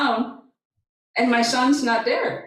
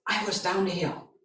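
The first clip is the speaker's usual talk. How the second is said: much softer retold reply; 2 semitones lower, 8 dB quieter than usual. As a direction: answer quietly, without any push. First, low answer to son.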